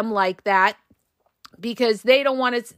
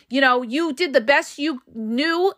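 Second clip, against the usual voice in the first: ordinary delivery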